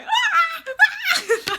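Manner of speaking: loud, very high-pitched re-enacted scream; panicked scream. Woman screaming while cutting her bug-filled hair, panicked. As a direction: shriek way up high in mock panic. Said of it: "the whole time, I'm just like".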